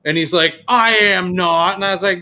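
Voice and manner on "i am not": slow, drawn-out higher protest; mock indignant denial. Man denies being a male Karen, mock indignant. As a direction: stretch every word in mock offence.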